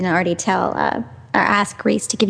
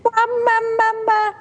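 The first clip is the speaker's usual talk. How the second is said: put-on much higher tiny baby voice; imitating baby; 13 semitones higher, slower than usual. Baby girl saying mama at eight weeks, much higher baby voice. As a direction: squeak it like an infant. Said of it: "Beth was saying mama".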